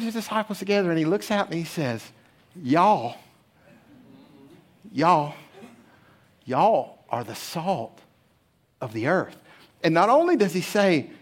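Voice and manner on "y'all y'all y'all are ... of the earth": slow, lowered voice, long pauses between phrases; deliberate dialect quote. Retold or imitated. Jesus addressing gathered disciples as y'all, slow and deliberate. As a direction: let each y'all hang in the silence.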